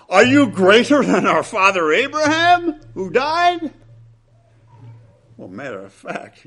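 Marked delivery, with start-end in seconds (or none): 0.1-3.7 mocking opponents. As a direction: throw the question back in a sneering, raised voice. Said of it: "their question".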